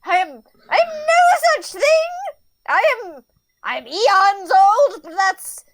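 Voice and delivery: high offended protest; old demon huffily denying senility; indignant outburst. Acted. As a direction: bristle at the insult.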